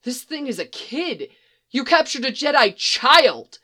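high, rising to a loud cry; appalled disbelief; horrified realisation. Acted. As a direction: trooper cries out in horror at a captured child. Trooper appalled at discovery.

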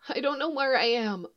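small voice. Acted. Lost girl answers Leia in a small, high voice. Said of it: "in a small voice".

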